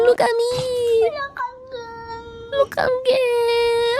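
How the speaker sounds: high, long-drawn cat yowl; cat imitation. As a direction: stretch it out like a wailing cat. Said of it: "the cat that says".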